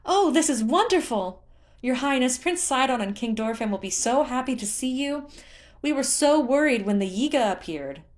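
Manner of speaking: high, loud breathless gushing; gushing excitement. Acted. Tula gushing to Link about Sidon and the king, high and loud. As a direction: pour it all out in delight.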